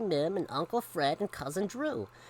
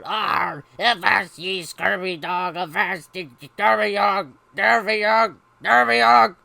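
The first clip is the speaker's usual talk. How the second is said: gruff mechanical pirate cry; bellowing pirate; 3 semitones higher, 11 dB louder than usual. Acted. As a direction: toy pirate squawking louder and louder. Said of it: "egg-shaped pirate that bellowed".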